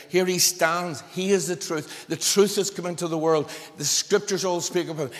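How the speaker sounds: preacher rousing the congregation, loud and forceful; fervent preaching. Acted. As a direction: drive it home from the pulpit.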